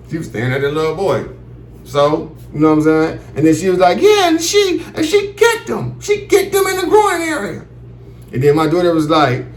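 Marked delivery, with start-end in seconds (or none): 4.0-7.6 high, rising into an outraged near-shout; prim lady getting worked up; school lady voice. Retold or imitated. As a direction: school lady telling dad about the kick, high and outraged. Lady tells the kick.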